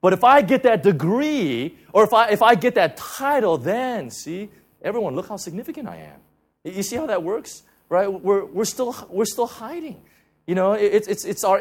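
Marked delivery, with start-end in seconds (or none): 0.0-6.2 imitating a puffed-up boast, louder; a person bragging to prove they matter, mocked; mock self-important inner voice. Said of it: "it's as if".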